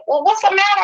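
high, exasperated worry; alarmed mother. Retold or imitated. Mother pressing her crying daughter on the phone, alarmed. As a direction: push anxiously for an answer.

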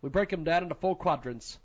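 soft, even sponsor read. Acted. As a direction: read the ad smoothly and quietly. Reads sponsor ad.

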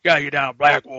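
a lightly voiced drawling cowboy from the game; Red Dead cowboy voice. Quoting the game's hero to his co-host, a light cowboy voice. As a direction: ease into a hint of the game's outlaw hero. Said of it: "that's how the main character talks".

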